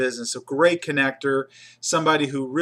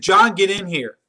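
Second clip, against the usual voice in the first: loud, higher call of an angry boss; boss barking; 5 semitones higher, 7 dB louder than usual. Retold or imitated. Boss summons John into his office, loud and sharp. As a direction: shout the name down the hall.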